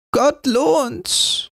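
put-on a grateful old beggar; old woman. A narrator's reading. Old beggar thanking Rotnas, thin crone voice. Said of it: "sprach die Alte".

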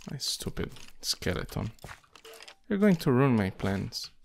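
ordinary talk

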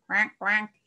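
loud duck quacking. A narrator's reading. Miss Duck calls to Mister Cow nearby, quacking loudly. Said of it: "you heard Miss Duck's voice".

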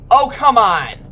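loud desperate complaint; frustrated shout. Real, not acted. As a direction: cry out in panicked frustration. Scared by monster.

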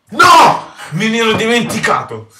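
sudden shout of dismay. Real, not acted. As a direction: yell out as it hits you. Realizes he forgot, shouts. (grido improvviso di sgomento)

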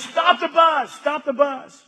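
high, loud urgent call to stop the bus; the guide yelling at the driver, urgent shout; retold shout. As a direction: shout it as the frantic tour guide. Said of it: "man, she says".

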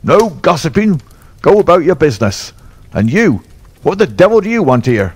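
put-on a fierce old trainer catching his groom; demanding shout at his stable hand; angry cry. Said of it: "he cried".

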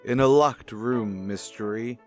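normal talking voice

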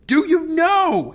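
full, high, drawn-out furious roar. A narrator's reading. Captain cutting off the girl's apology, roaring. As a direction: bellow it slowly at the top of the voice. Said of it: "he now roared".

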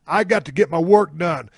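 usual speaking voice

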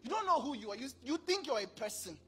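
ordinary talk

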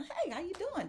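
high bright mock greeting; cheerful greeting. Vlogger greeting the shopper in the store, high and cheerful. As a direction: call out hello, pitched well up.

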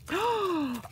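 sharp delighted gasp, high; excited gasp. Real, not acted.

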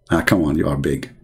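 normal talking voice

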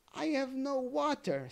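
high, lightly voiced bird complaint; a small bird's mild lament; sad lark. Captive lark complaining of thirst, high and mild. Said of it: "said the captive lark".

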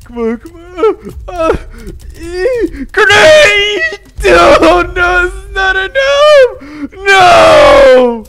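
high, loud, despairing cries; desperate yelling. Real, not acted. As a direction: yell as the defense collapses. Last desperate attempt failing.

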